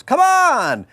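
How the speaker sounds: high, loud mock cheer; imitating a friend's high, loud rallying cry; excited shout. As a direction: yell it like egging on a crowd. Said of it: "and he goes".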